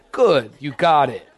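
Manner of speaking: ordinary talk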